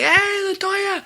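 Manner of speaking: very high, drawn-out call without real volume; mock yell. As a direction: imitate hollering for someone's attention. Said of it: "So I yelled at her".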